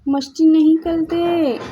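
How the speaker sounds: firm scold at the naughty cats. Real, not acted. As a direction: drag out मस्ती नहीं करते loudly with a falling end.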